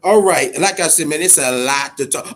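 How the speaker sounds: normal talking voice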